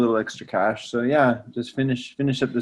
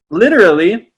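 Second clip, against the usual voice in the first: usual speaking voice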